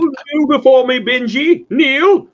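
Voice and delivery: loud, high commanding villain. Retold or imitated. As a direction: bark the order to kneel.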